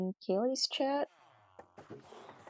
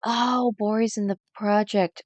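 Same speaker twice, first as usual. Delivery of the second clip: louder, higher gasp of delight; surprised gasp; 4 semitones higher, 7 dB louder than usual. Real, not acted. Streamer spotting Bori joined, louder surprised gasp. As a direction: gasp as if catching news. Gasps at news in chat.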